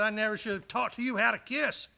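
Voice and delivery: ordinary talk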